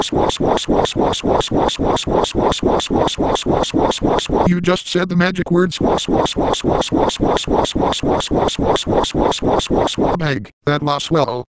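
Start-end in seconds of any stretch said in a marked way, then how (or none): 0.0-4.5 1 whispered swa chant. Pretend. Rhythmic magic chant noise. 5.7-10.2 1 whispered swa chant. Pretend. The magic word chanted.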